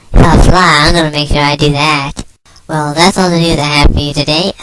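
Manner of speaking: normal talking voice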